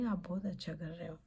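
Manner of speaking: ordinary delivery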